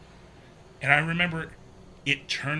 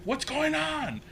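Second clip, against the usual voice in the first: high-pitched retold mom yell; mom's yell; slower than usual. Mom at the foot of the stairs, mock yelling. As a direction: imitate a panicked yelling mother. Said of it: "she just yells".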